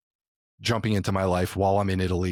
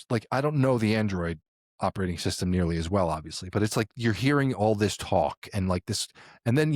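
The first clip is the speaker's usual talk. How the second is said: normal talking voice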